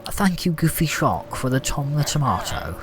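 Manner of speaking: posh English thank-you; posh accent. Pretend. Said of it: "act posh for a minute".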